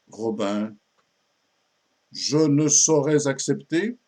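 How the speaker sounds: mildly offended refusal; offended pupil. Acted. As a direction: refuse with a touch of affront. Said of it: "Marion commence par s'offusquer". (élève légèrement offusquée)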